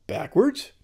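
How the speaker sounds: high, rising puzzled question; imagined puzzled student. Pretend. Student balks at reversing the letters, puzzled high question. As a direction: query it as a thrown student would. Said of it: "So you start going".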